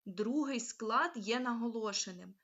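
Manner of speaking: ordinary delivery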